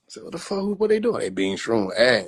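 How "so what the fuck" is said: usual speaking voice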